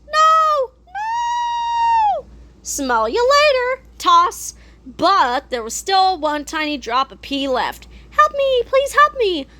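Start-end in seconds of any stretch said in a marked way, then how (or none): 0.1-2.3 anguished cry. Acted. Villain cries out losing. 8.1-9.5 tiny squeaky voice. Acted. Tiny pee drop pleading.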